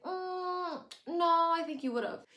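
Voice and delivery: haughty mother correcting her daughter; mock lofty know-it-all; imitating Emily. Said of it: "And Emily's like".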